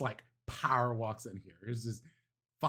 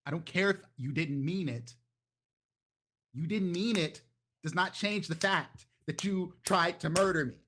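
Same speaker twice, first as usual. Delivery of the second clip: higher and louder, furious at her other self; angry retort; 6 semitones higher, 4 dB louder than usual. Acted. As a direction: snap back hard, she tried to murder you. Dolce arguing with her other self.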